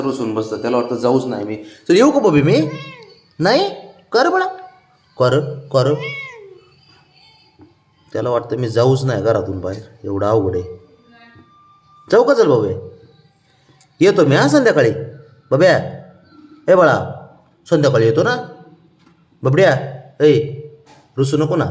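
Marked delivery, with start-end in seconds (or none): 1.6-5.9 high, loud sing-song coaxing. Real, not acted. Man coaxing his sulking pet before leaving, high baby talk. 12.1-20.3 high, loud sing-song coaxing. Real, not acted. Man coaxing his sulking pet before leaving, high baby talk. Pet asked not to sulk. 21.2-21.6 high, pleading sing-song; coaxing plea. Real, not acted. Owner begging his sulking pet, high coaxing plea.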